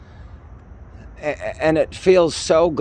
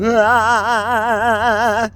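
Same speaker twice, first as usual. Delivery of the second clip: high, loud newborn wail; newborn cry; 11 semitones higher, 4 dB louder than usual. Pretend. Newborn greeting the world with a high loud wail. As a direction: shriek like a baby just born. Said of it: "I came out going".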